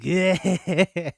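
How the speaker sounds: loud imitated creepy giggle; loud mock creepy cackle; creepy laugh. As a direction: do the girl's creepy gross-out laugh. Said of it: "her creepy laugh".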